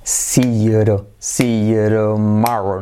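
"see you tomorrow" slowly linked as "siyu-ro-MA-ro"; slow drill of "see you tomorrow" with tapped T; slow repeat-after-me model. Shown as a demonstration. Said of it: "repite conmigo".

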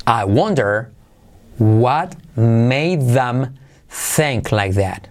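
very slow, every sound drawn out; slow teacher model; slow demo. Shown as a demonstration. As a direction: stretch the phrase for learners. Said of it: "Me pregunto".

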